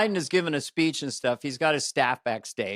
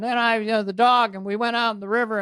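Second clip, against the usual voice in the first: put-on higher-pitched rambling old politician; Biden; 6 semitones higher than usual. President rambling off the teleprompter, higher voice. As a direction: meander along off the script, pitched up. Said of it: "So Biden's out there".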